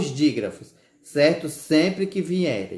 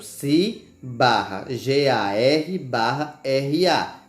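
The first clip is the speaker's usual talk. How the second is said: slow, letter-by-letter spelling of cigarra; slower than usual. Shown as a demonstration. Teacher spelling cigarra syllable by syllable, slowly. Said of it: "nós temos cigarra".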